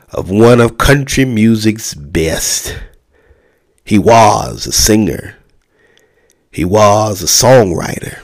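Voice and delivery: slow, solemn funeral address; faltering tender eulogy. Acted. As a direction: deliver it like a pastor at a memorial.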